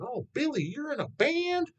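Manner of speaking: high, slow, doting grandma voice; sweet old Nana. Retold or imitated. Grandmother asking her grandson about his band, high doting voice. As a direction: coo it like a kindly grandmother. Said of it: "Nana asked you something".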